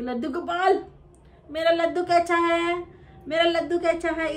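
high, loud doting grandma voice; imitating grandma. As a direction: coo like a fond grandmother. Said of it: "दादी ऐसे बोलते हैं कि".

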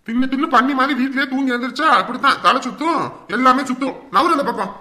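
loud, high scolding tirade; angry berating. Acted. Husband mocking wife's dizziness excuse, angry berating. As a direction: tear into them at full volume.

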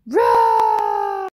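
huge high shouted roar; mighty roar. Acted. Allosaur finding its roar at last, huge shouted roar. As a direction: blast it out triumphantly. Roar finally comes back.

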